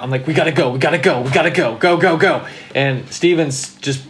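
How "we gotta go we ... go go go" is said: retold panicky urging, rapid repeats; mildly frantic, pushing everyone to get out; freaking out. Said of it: "I start freaking out".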